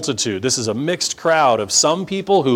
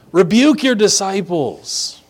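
higher-pitched, mock-indignant command; imperious demand; 5 semitones higher than usual. Pharisees commanding Jesus to rebuke his followers, imperious. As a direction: order it as if entitled to.

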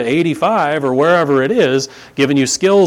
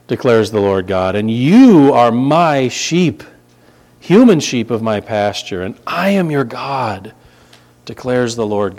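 scripture reading; 4 dB louder than usual. Acted. Reads scripture aloud slowly.

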